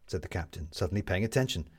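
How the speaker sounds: ordinary delivery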